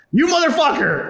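high-pitched acted-out outrage; indignant cursing. Man cursing the bank teller from his scooter, indignant. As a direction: hurl it high, reliving the grudge.

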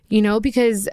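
choking up with real tears; crying. Real, not acted. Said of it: "I'm sorry, I'm crying".

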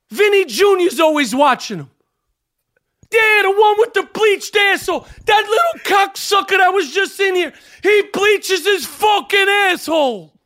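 put-on angry old Brooklyn pizza man yelling; furious pizzeria owner shouting. Sal character rant.

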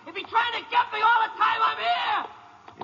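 high, frantic fear, slowing to a yell; rising panic. Acted. Prisoner protests to the ranger about Foley, panicking. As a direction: spiral into terror.

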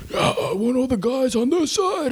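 a goofy high parody of a sergeant; mocking the frozen leader. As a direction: ham up a clueless officer barking orders. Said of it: "this motherfucker comes out".

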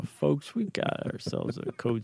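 calm captain breaking bad news; airline pilot announcement. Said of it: "It's your best impression".

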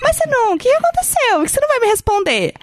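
high, whiny imitation of a needy man; mock pestering suitor. Woman mimicking the stranger who demands a reply, mock pleading.

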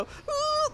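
high mock whimpering ooh; imitated whine. Retelling the proposal, he mimics her high whine. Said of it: "she starts to whine".

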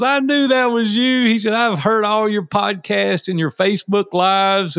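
imitating a thrilled fan, pitched high; high, eager quote of an admirer; excited recognition. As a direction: play a fan who has just recognized someone. Excited stranger recognizing the speaker.

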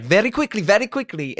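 high, impatient Italian-accented imitation; mock Italian accent, grudging urgency; pissed-off Italian accent. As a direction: mimic an annoyed Italian woman hurrying someone. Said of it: "she's all pissed off".

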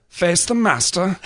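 James Mason impression. Showing friends his James Mason, slow and haughty. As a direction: savour each word like a haughty film villain. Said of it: "I do a good James Mason".

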